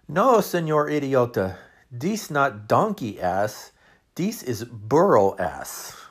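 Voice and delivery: put-on loud, slow, heavy Mexican accent; serious Mexican accent. Waiter Ramon sternly corrects the dad, slow Mexican accent. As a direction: correct him gravely in a thick Mexican accent. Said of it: "got a serious look on his face".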